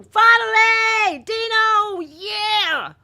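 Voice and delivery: slow, high, loud cheer; mock crowd cheering a star; drawn-out cheer. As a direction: stretch out the relief. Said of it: "all of us were like".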